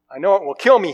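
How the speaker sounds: a hint of panic in the ghost's voice; frightened ghost man.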